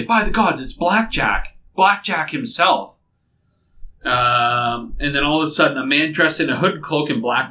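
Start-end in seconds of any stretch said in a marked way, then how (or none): 0.0-3.0 amazed crowd. Acted. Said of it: "you can hear people say".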